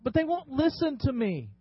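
a nervous Moses begging God to pick someone else; flustered Moses. Acted. Pastor voicing Moses as a flustered, stammering pleader.

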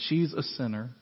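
"She's a sinner" read softly, flat, no emphasis; flat throwaway read. Shown as a demonstration. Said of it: "you don't read it".

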